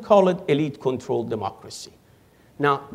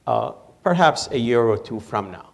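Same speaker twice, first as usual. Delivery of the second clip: usual speaking voice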